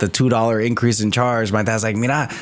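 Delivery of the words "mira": high-pitched mock rant in Spanish; dad yelling. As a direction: imitate an irate dad ordering his kid to translate. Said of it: "yell at them this way".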